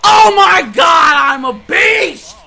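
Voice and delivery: high, loud, slow holler; excited boasting shout. Real, not acted. Gamer celebrating his own play, triumphant shout. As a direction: stretch it out in triumph.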